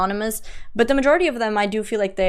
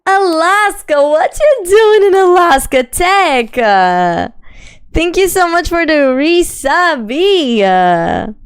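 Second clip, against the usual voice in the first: excited greeting; 7 semitones higher, 6 dB louder, slower than usual. Real, not acted.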